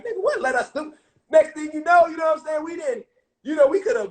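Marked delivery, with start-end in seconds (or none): 0.0-0.9 incredulous challenge. Retold or imitated. Blocked from reaching Satan.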